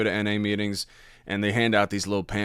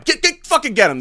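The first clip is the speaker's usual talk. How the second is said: high, mock frantic order; imitating cops; 6 dB louder than usual. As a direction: parody cops piling on. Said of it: "And they're like".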